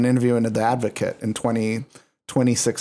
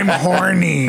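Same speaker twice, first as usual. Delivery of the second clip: sassy drag queen; 4 semitones higher, slower than usual. Retold or imitated. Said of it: "she's like".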